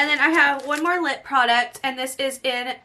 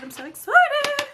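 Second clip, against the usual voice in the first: much higher, louder squeal of joy; excited squeal; 13 semitones higher, 5 dB louder than usual. Real, not acted. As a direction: let the excitement burst out high. Unboxing a long-wanted palette.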